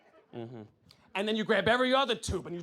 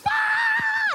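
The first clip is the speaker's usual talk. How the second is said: very high, loud scream; panicked scream; 8 dB louder than usual. Retold or imitated. Comic hearing the cricket get sucked up, screaming. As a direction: shriek and run. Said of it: "It's like".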